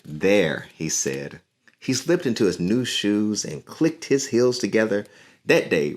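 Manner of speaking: lively storybook reading; reading to children. A narrator's reading. Teacher reading a picture book to her class, lively. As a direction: read the story aloud to young listeners.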